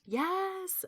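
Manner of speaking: high, drawn-out eager yes; delighted to get started, pitch leaping up; excited yes. Real, not acted.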